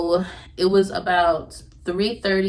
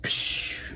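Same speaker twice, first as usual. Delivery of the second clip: gush sound. Retold or imitated. Imitating water gushing out.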